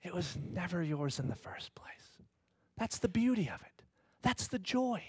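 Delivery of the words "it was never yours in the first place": slow, soft unvoiced whisper; God's gentle whisper. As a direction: breathe it gently like a secret. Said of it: "God whispers to us and says".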